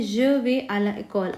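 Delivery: je vais à l'école read very slowly; slow French example. Shown as a demonstration. Teacher slowly reading a French example sentence. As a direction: lay out the French example word by word. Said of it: "अब next पे हम आते हैं".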